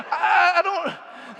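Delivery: high, slow nervous laughing stammer; frightened stammer. Retold or imitated. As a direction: stumble over the words in fright. Terrified Israelites voiced.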